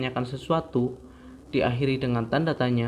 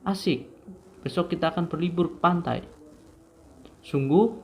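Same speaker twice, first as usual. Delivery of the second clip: higher, bright-eyed wonder on each exclamation; demo exclamation; 4 semitones higher than usual. Shown as a demonstration. Teacher demonstrating exclamation sentences to students, excited and higher. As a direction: act out amazed delight for the class. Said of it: "memiliki intonasi yang terkadang tinggi".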